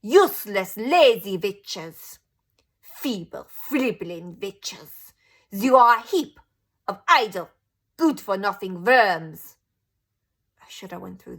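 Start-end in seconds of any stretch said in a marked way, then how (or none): 0.0-9.6 slow, much louder scolding; furious witch rant. A narrator's reading. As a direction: hammer each insult at them. Grand High Witch berates audience.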